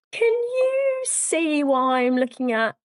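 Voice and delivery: high, drawn-out, sheepish question; embarrassed client. Retold or imitated. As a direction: imitate a client gingerly asking something awkward. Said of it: "puts that person in a slightly embarrassing situation".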